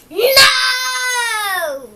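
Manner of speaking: loud, high wailing shout. Acted. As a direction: yell the no with outrage. Pigeon protesting unfairness.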